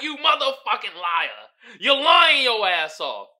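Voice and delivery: loud, slow accusing; angry accusation. Retold or imitated. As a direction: call her out loudly. Voicing dog accusing.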